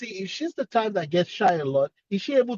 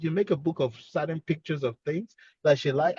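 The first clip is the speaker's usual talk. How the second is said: ordinary talk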